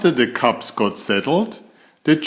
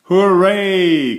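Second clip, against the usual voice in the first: high, loud hooray; cheering cry; 8 semitones higher, 5 dB louder than usual. A narrator's reading. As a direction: cheer at the top of the voice. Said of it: "cried Sister".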